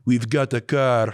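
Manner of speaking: slow mock Russian accent; movie-style Russian accent, drawn out. Rival bursting through the door with a car, Russian accent. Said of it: "I don't know why he's Russian".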